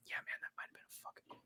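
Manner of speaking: fast conspiratorial whisper; mock whisper. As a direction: whisper it like a secret between refs. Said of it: "they're even like".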